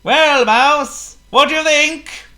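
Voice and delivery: high, loud call over shoulder; calling back. Acted. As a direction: shout back in the wind. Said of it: "called Potto over his shoulder".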